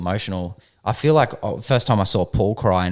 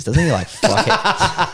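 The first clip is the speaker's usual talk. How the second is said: laughing; 10 semitones higher, 4 dB louder, much slower than usual. Real, not acted. Laughing joke about a friend.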